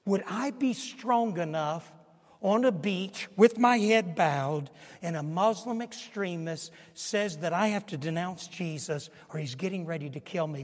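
ordinary talk, nothing marked

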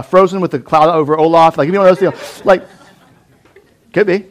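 usual speaking voice

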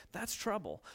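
normal talking voice

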